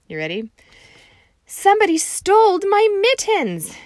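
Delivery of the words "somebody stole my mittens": very high, loud distraught cry; upset kid wail. Acted. Junie B. calling her teacher, high loud distraught cry. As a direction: wail it so the teacher comes running. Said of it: "Missus came very quick".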